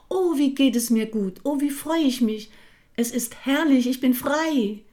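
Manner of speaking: high, loud cries of delight; overjoyed. A narrator's reading. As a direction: soar with joy as you say it. Drop flying up, overjoyed.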